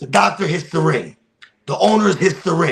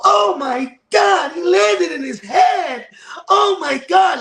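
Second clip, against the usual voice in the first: mocking shriek; slower than usual. Mocking the online crowd who watched the video, high shrieks. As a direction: shriek it like a panicky crowd you despise. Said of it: "like little fucking bitches".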